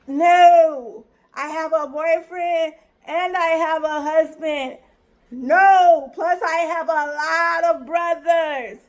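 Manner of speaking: slow, emphatic listing with loud noes; insistent refusal. Retold or imitated. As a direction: spell out the rejection, drawing it out. Insistent refusal of his advances.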